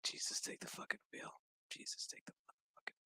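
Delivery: fast, barely voiced plea; whispered mock prayer. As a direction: whisper it under your breath, exasperated act. Her muttered prayer, retold.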